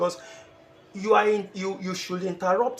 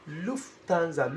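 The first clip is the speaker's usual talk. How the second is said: Lufthansa slowly and carefully, syllable by syllable; deliberate careful Lufthansa; said with caution; slower than usual. Shown as a demonstration. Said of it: "you are saying it with caution".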